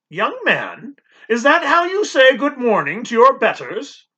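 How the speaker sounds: disapproving housekeeper demanding manners; stern housekeeper. Acted. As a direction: rebuke the boy like a strict housekeeper. Housekeeper scolds the boy.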